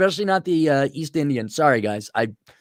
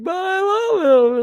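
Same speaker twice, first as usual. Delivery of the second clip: very high, drawn-out mock girl voice; lovesick girl; slower than usual. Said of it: "Some of you girls are just like".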